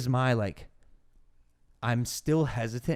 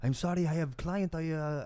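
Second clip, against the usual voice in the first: higher, lightly put-on Russian accent; a driver apologizing to a fighter in a put-on Russian accent; mock Russian accent; 6 semitones higher, 3 dB quieter than usual. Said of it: "I don't know if this is a Russian accent".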